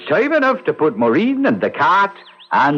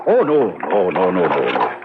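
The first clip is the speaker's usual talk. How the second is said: each no sliding lower and lower; falling denial; 12 semitones lower than usual. Acted. Leprechaun teasing the doctor, denials dropping ever lower. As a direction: sink down the scale teasingly.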